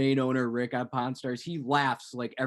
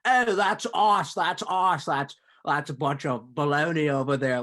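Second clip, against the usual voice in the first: mock heckler; 4 dB louder than usual. Mocking MPs heckling the president.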